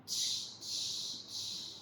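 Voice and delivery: three soft voiceless hisses; monkey shushing noise. A narrator's reading. Monkeys answering the peddler, mocking hissed shushes. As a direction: imitate monkeys with short separate shushes. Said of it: "This is the noise that the monkeys are making".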